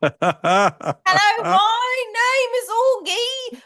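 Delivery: laughing through a high, slow mock British accent; clumsy British accent while cracking up; bad British accent. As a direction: break into giggles mid-accent. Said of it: "put me on the spot and accents like this".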